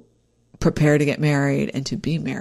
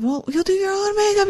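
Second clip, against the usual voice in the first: much higher, coaxing voice; self-reassurance. Retold or imitated. Bride reassuring herself about the makeup, high and coaxing. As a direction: talk yourself down like soothing a child. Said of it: "And then I was like".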